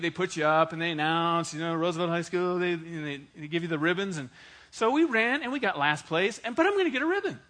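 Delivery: usual speaking voice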